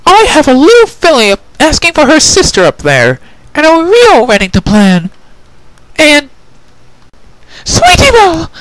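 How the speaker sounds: put-on high, loud indignant young mare; Twilight angry. Twilight lectures the prisoner, loud and angry.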